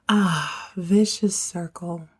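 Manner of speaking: usual speaking voice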